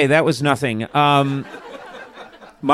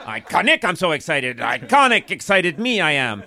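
high-pitched, over-eager mimicry of a guest; teasing impression of an excited man rehearsing; mock rehearsing guest; 4 semitones higher than usual. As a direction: practise the line over and over, hammed-up. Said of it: "He was riding in his car".

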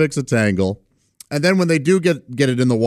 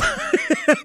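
very high quick giggle; brief, real burst of chuckling; short laugh. Real, not acted. Uncle recalling niece ripping worms, quick giggle.

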